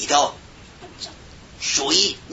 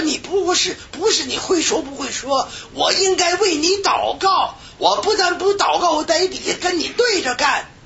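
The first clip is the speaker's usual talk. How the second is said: retold tearful confession; 13 semitones higher than usual. Young man's tearful confession retold.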